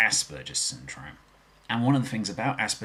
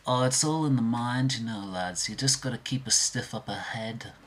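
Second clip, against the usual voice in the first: Beatle impression; 3 semitones higher than usual. Shown as a demonstration. Beatle reassures the lads, Liverpool accent. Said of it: "I can impersonate all four of the [name]".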